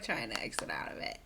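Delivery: hushed, sneaky whisper. Retold or imitated. Vlogger whispering as Amber quietly stops the recording. Mimicking her sneaky phone fumbling.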